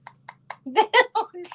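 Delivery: high, breathy giggle; high laugh. Real, not acted. Watching Daisy in the background, high giggle. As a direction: giggle at the dog's antics.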